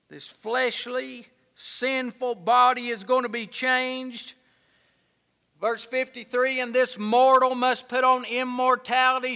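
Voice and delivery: loud, ringing King James reading, slowing at the ends; preaching scripture. Acted. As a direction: proclaim the verses to the congregation. Fervent scripture reading aloud.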